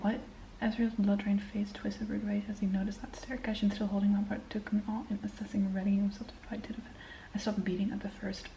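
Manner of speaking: soft whispered read-aloud; hushed bedtime-style reading. Acted. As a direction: whisper the lines as you read.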